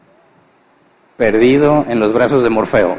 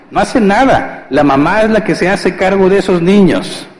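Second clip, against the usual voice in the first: mock complaint; 5 semitones higher than usual. Acted wives complaining about husbands.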